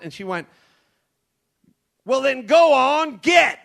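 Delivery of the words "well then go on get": slow, sharp order to clear off; shooing shout. Retold or imitated. TSA agent loudly shooing a passenger away. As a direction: shout it high and loud, waving someone off.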